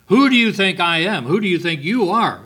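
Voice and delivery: high, loud offended demand; indignant outburst. Retold or imitated. Pastor acting out a proud quarreller's indignant outburst. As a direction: bristle with wounded pride. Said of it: "elevate himself above the other".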